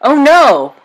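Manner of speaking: high, loud "Oh no!"; shocked dismay at full voice; frightened cry. A narrator's reading. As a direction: Pocahontas realizing the innkeeper is inside, crying out. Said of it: "Pocahontas cried".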